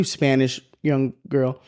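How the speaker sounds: usual speaking voice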